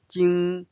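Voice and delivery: 京 held high and level; first tone. Shown as a demonstration. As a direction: keep 京 high and flat. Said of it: "first tone".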